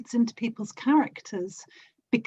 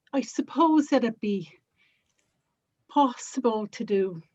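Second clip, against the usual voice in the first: attempted Derry accent, tentative, phrase by phrase; Northern Irish lilt from Derry, feeling the way in. Shown as a demonstration. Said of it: "trying to do kind of Derry".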